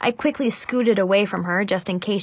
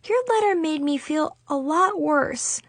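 high, small, hurt voice; wounded and high-pitched; sniffling; 9 semitones higher, 3 dB quieter than usual. A narrator's reading. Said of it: "Chloe sniffed".

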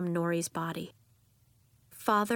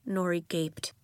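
ordinary delivery, nothing marked

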